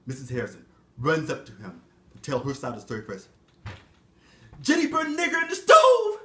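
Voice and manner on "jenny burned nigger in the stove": shrill accusation. Acted. Repeated accusation.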